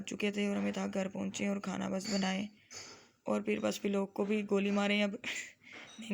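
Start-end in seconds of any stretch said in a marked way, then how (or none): 5.1-6.1 laughing. Real, not acted. Laughing off her own joke.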